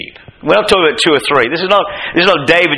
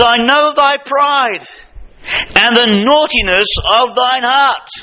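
much higher, slow accusing voice; Eliab accusing; 8 semitones higher, slower than usual. Retold or imitated. Eliab accusing young David of pride, higher and slow. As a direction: drag out the accusation. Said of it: "He says".

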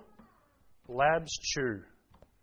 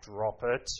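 mock growled command, lower; imitating daughter's growl; 6 semitones lower, 3 dB quieter than usual. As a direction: copy the little girl growling at the dog. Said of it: "I can hear her growling at my dog".

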